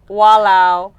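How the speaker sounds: walao, loud and fed up; exasperated walao. Shown as a demonstration. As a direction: shout walao in dismay. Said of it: "and I'm like".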